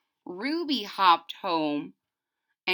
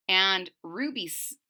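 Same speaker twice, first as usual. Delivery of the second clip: plain reading voice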